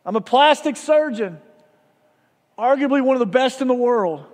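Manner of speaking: a surgeon calling loudly through the bedroom door. Retold or imitated. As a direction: project it so the man behind the door hears. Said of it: "he called out loudly".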